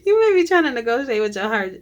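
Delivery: high, fast burst through laughter; amused, laughing. Real, not acted. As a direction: blurt it out while cracking up. Cracks up at her own reading.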